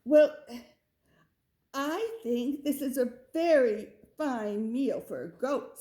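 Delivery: loud, bright mother goat pleased with dinner; a hammed-up proud mother goat voice. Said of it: "said Mother Goat".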